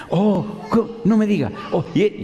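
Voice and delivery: high-pitched mock astonishment; changed tone. Caller learning it is the pastor, suddenly changed tone. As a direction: imitate her sudden impressed surprise. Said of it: "ya cambió el tono".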